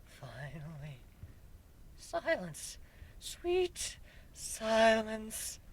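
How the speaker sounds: very soft, slow, savoring relief; relieved sigh. Acted. Griffin lying on his back after the noise stops, soft relief. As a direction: breathe it out like bliss.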